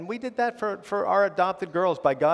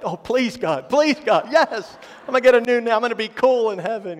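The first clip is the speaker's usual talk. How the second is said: high, mock-desperate begging that turns to glee; eager pleading; 7 semitones higher, 5 dB louder than usual. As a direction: beg and celebrate in a hammed-up high voice. Acts out eager plea to God.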